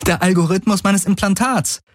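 high, elated realization; light up with relief, pitched high; relieved. A narrator's reading. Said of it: "diesmal erleichtert".

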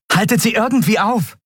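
high-pitched shouted command; urgent order. A narrator's reading. Andriel commanding her two comrades, urgent shout. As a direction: order them to stall the enemy.

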